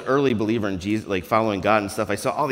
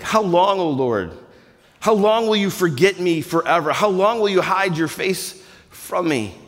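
loud, high, anguished plea; crying out to God; 7 semitones higher, 5 dB louder than usual. Acted. Psalmist pleading loudly with God, anguished. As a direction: cry it out raw and loud.

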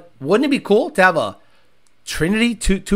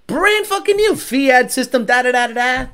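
usual speaking voice

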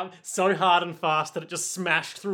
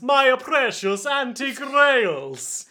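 high, slow, louder wail; wailing old caretaker; 8 semitones higher, 4 dB louder, slower than usual. Retold or imitated. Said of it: "the Colosseum caretaker is like".

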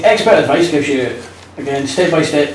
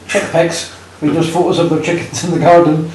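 ordinary delivery

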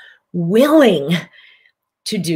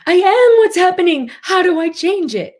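panicky reactor voice; 12 semitones higher, 6 dB louder than usual. Acted. Voicing the reactive self.

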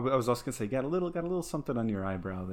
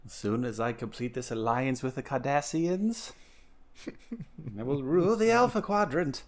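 grand Star Trek villain, rising louder; megalomaniac rant; 2 semitones higher, 4 dB louder than usual. Pretend. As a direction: gloat like a space tyrant. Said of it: "Star Trek villain".

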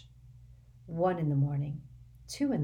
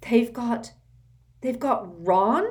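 louder, stammering alarm; 6 semitones higher, 6 dB louder, slower than usual. A narrator's reading. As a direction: panic rising at the news. Shocked realisation.

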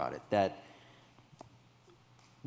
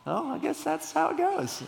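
much higher, bewildered shrug of a line; dazed resignation. Retold or imitated. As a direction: play the stunned kid, half laughing it off. Left standing at the curb.

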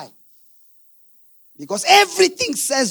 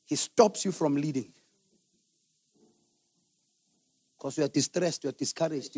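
soft, low, intimate sermon voice; hushed preaching; 6 semitones lower, 9 dB quieter than usual. Acted. Pastor warning wives about a depressed man, hushed and low. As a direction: drop right down and draw them in. Quiet reflective preaching stretch.

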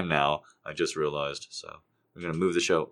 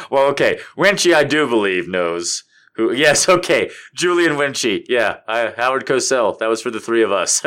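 louder, higher broadcaster voice; hint of a sportscaster; Howard Cosell impression; 3 semitones higher, 10 dB louder than usual. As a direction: announce it ringside. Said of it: "impression of Howard Cosell".

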